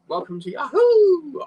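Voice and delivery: high, loud, slow Yahoo jingle voice; phone line greeting. Retold or imitated. Recalling the Yahoo email line, cheery recorded greeting. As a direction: sing out the brand greeting like the old line. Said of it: "they used to go".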